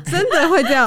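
high, loud laughing words. Real, not acted. Both laugh at the joke.

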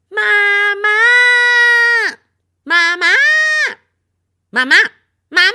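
very high, loud wail of mama; little girl shrieking for mum; calling for mum. Acted. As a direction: cry out for mother as a spell. Little sister's spell calling mum.

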